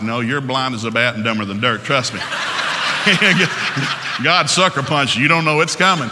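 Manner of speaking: normal talking voice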